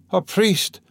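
a weak man calling out again; high, pained request; dying man's plea. A narrator's reading. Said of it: "the dying man said again".

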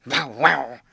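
loud barked words; dog bark. A narrator's reading. Dog dreaming of his stove, barking. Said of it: "barked the yard dog".

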